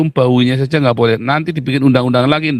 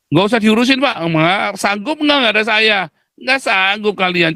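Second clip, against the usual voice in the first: higher, slowed mock of the crowd; mock imitation of the listeners, pitched up; 8 semitones higher than usual. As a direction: mimic them high and whiny. Mocking audience's retort.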